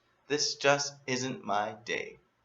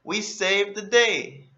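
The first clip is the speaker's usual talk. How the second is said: triumphant; 9 semitones higher, 6 dB louder, slower than usual. Acted. Triumphant hero line.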